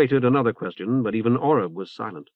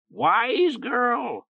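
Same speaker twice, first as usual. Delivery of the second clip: high croaking bird squawk; hoarse bird voice; 12 semitones higher than usual. Acted. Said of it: "Oreb approved".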